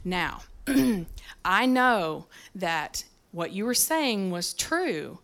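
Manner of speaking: quiet and deliberate, with patient pauses; very calm. Retold or imitated. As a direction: imitate an unruffled boss easing into correction. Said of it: "he sat there very calmly".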